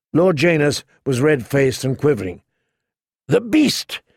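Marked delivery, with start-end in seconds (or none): 3.3-4.0 sharp high gasp of horror. A narrator's reading. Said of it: "he gasped".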